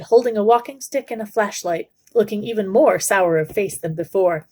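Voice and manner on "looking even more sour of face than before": plain reading voice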